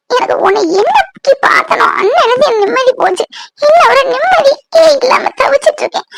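high, tearful tirade; anguished anger. Acted. Woman blames him for her lost peace, anguished. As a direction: pour out distress in a high voice.

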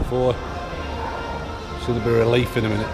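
ordinary delivery

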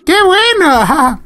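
high, drawn-out cheer; gleeful cheer. Real, not acted. Player cheering at the game, gleefully high. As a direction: stretch it out high, breaking into laughter.

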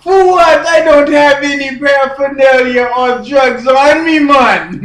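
loud, high, put-on Jamaican accent. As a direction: push it like a hollering comic Jamaican. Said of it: "He's from Jamaica".

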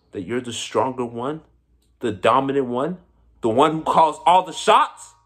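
scornful questions climbing to a loud peak; building challenge. Acted. Mocking challenge to his enemy.

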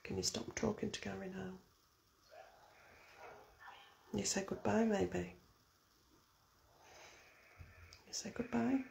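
hushed, very soft prompting; barely audible, coaxing request; whisper. Real, not acted. Prompting someone to say goodbye, whispering. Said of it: "I've been really whispering".

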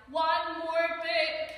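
loud, slow excited hype. Real, not acted. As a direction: hype the next book. Hyping next video.

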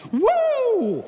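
a sudden, very high startled "Woo!"; startled yelp. Retold or imitated. Pedestrians spooked by the burning bush yelping. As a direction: jump back from a bush that comes alive. Startled walkers jump aside.

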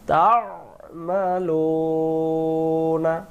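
তা'মালুনা with the ain from the throat, slow and chanted; slow recited তা'মালুনা with the throat letter ain; correct throat sound. Shown as a demonstration. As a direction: bring তা'মালুনা up from the throat, stretched. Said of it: "গলায় লাগবে".

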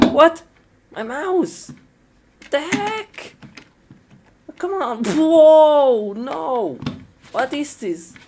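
high-pitched, wailing outburst of dismay; alarmed disbelief. Acted. Gamer whose mouse fails mid-game, alarmed. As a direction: exclaim in rising panic, voice jumping high. Computer mouse suddenly misbehaves.

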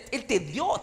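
usual speaking voice

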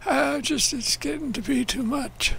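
high, slow whiny complaint; mock despair. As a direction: whine it like a beaten soldier. Complaining after dying again.

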